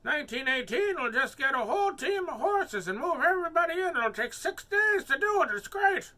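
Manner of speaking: high, loud hammed-up old man. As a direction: crow it out like an excited old-timer. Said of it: "why were you doing an old man voice".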